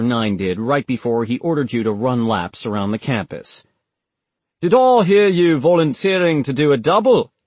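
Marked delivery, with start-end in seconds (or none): 4.6-7.3 higher, louder jovial commanding officer; cheerful threat of punishment; good-natured officer. Acted. Officer teases McLaughlin about a double shift, loud and jovial. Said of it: "sounding good-natured".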